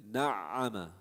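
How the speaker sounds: na'ama slowly with the ayn sounding, no break; slow demo of na'ama without a stop; unpaused ayn. Shown as a demonstration. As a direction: glide through the ayn in na'ama. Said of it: "You don't pause".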